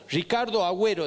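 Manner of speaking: ordinary talk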